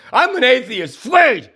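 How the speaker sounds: mock atheist shout.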